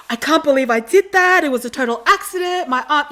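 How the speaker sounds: high, loud retold protest; frantic explaining. Mortified fan explaining the accident to another girl, frantic protest.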